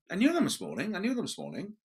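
high, whiny protest; exasperated actor. Retold or imitated. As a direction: repeat it in a plaintive pleading tone. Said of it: "you knew them this morning".

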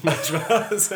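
laughing it off, high and loud. Real, not acted. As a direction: burst out laughing. Laughs off her own joke.

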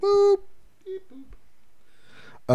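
squeaky boop. Pretend. Comic beep sound.

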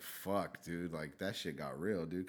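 low, soft, sobered retelling; subdued. Retold or imitated. Recalling the car ride after the shooting, subdued. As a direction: let it sink in quietly. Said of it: "It was more quiet".

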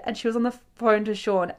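ordinary talk

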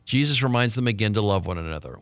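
ordinary talk, nothing marked

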